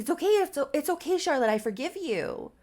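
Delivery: high, loud, panicky reassurance; acted panic. Leif forgiving crying Charlotte, panicking. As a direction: blurt it out in mock panic. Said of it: "panicking now".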